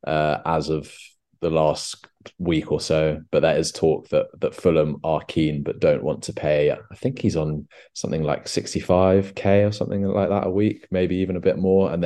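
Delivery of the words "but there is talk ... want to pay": ordinary talk, nothing marked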